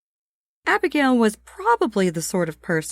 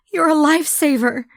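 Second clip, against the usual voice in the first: put-on grateful young woman; 9 semitones higher, 5 dB louder, faster than usual. Abigail thanks Merle.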